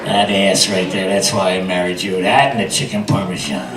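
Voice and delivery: very low, soft murmur; seductive husband. Acted. Husband tells wife why he married her, low seductive murmur. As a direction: croon it low and quiet, savoring her.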